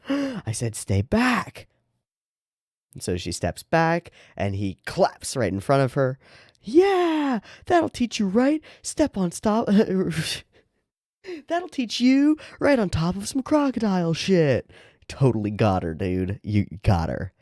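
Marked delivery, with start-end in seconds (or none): 0.1-1.7 high-pitched, alarmed character voice; alarmed warning. Acted. Acted warning to stay away. 6.6-14.7 very high, gloating character voice; gloating game character. Acted.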